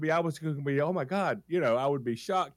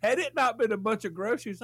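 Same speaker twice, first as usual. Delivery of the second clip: usual speaking voice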